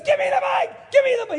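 very high, frantic mock shriek; imitated demonic screaming. Preacher imitating the heckler demanding the microphone, screaming. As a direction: screech the demand like a man possessed. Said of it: "he's yelling and screaming".

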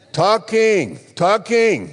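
preacher drumming in one word; playful hammering. Real, not acted. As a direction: hit each word high and loud, letting it fall.

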